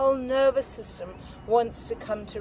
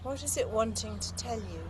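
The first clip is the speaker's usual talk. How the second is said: guided meditation; 5 dB quieter than usual. Acted. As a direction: gently invite inward attention. Opens with guided meditation cues.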